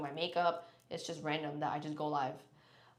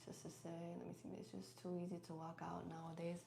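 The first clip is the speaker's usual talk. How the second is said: very soft murmured reading; reading to herself; 14 dB quieter than usual. Real, not acted. Streamer reading a viewer's comment, murmuring to herself. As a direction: read it under your breath, barely voiced.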